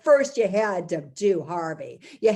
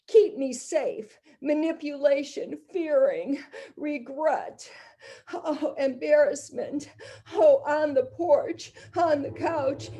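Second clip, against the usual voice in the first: higher, pleading victim voice; frightened young woman; 7 semitones higher than usual. Acted.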